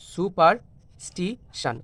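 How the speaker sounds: Superstition slowly, gaps between the syllables; broken into syllables. Shown as a demonstration. Teacher pointing to the correct option, superstition syllable by syllable. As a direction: draw out superstition piece by piece. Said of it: "এইভাবে ভেঙে ভেঙে উচ্চারণ করি".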